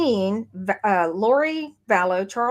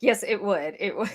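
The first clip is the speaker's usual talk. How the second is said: amused agreement, laughing. Real, not acted. Co-host agreeing about the accent joke, laughing. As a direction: chuckle through the agreement.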